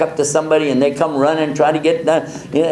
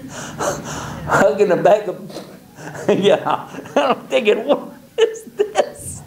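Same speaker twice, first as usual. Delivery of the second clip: giggling through the words, pitched up; breaking up with laughter; laughing; 6 semitones higher, much slower than usual. Retold or imitated. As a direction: laugh while telling it. Hugging a bag of manure.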